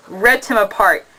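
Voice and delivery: ordinary delivery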